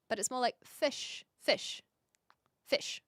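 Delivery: fish said with a short, clipped i, repeated; drawing out fish the Kiwi way; Kiwi fish said slowly. Shown as a demonstration. Kiwi streamer shows chat how she says fish. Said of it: "we don't say fush".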